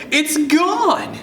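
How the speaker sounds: very high, alarmed wail; dramatic high-pitched child's screech. A narrator's reading. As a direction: pitch it way up in panic. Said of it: "you screech".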